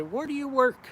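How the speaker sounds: imitating a curious customer at a gig; mock-curious customer asking where he works; mock customer question.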